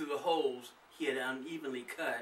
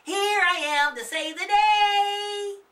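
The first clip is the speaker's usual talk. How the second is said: high, loud, slow heroic cry; Mighty Mouse; 12 dB louder, slower than usual. Acted. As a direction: boom it like a cartoon hero. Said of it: "a phrase Mighty Mouse would use".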